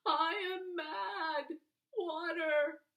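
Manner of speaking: high, loud, slow anguished howl. A narrator's reading. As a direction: howl it like a boy losing his mind. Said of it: "and howls".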